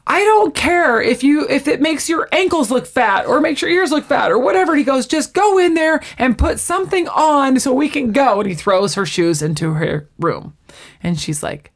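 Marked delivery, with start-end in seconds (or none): 0.0-4.7 higher-pitched mock losing it; retold exasperated outburst. Ross loses his mind over the shoes, mock exasperated. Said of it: "And then Ross loses his mind". 5.1-8.4 high-pitched impatient mock order; retold exasperated outburst. Ross orders Rachel to get dressed, mock exasperated. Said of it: "And then Ross loses his mind".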